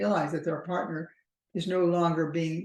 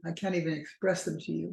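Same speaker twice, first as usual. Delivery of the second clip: ordinary delivery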